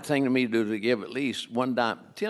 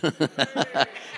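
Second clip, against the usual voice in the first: high-pitched short laugh; 5 semitones higher than usual. Real, not acted. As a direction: laugh at your own punchline. Laughs after inheritance joke on children.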